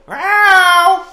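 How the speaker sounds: high squealed cat meow. Pretend. Imitating the cat.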